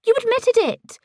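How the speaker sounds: very high, loud accusation; shrill accusation. A narrator's reading. Ellie catching Paisley out, shrill accusation. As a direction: fling the accusation at her.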